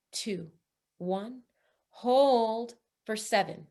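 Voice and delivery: pace the breath gently, letting each count drop away; guided breath count. Acted.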